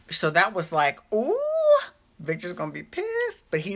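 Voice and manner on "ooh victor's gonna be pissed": high squeal of mock alarm on ooh; gleeful fake worry; mock gasp. Fan squealing over Jack's betrayal, mock alarm.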